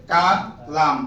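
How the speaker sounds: কালাম split into two parts, কা and লাম; teacher breaking up Kalam for learners; syllable by syllable. Shown as a demonstration. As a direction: sound out Kalam slowly in separate syllables. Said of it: "উচ্চারণ শুধু, বানান নাই আর".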